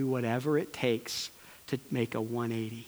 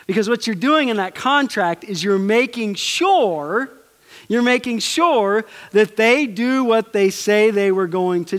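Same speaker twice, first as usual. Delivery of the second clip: louder, higher impassioned sermon; preaching at full force; 5 semitones higher, 7 dB louder than usual. Real, not acted. Preacher contrasting contract and covenant, impassioned preaching. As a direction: drive the point home to the congregation.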